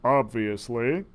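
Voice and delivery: low, slow and cool; Wild Willie replying coolly. A narrator's reading. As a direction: drop it coolly and unimpressed. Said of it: "he replied coolly".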